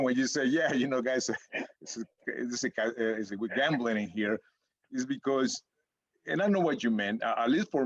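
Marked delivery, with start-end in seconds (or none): none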